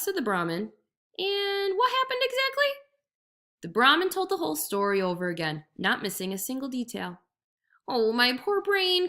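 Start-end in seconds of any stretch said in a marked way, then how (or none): 1.2-2.7 much higher, innocent-sounding question; jackal, high and curious. A narrator's reading. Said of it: "jackal asks". 7.9-9.1 high, slow hammed-up lament; mock despair. A narrator's reading. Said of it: "cried the jackal".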